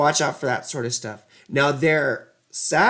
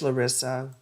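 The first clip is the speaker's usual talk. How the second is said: normal talking voice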